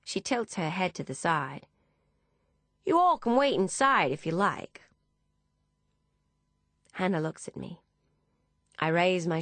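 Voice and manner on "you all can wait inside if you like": high-pitched Texan woman; Texan accent. A narrator's reading. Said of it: "in an accent similar to Deborah's Texan friend".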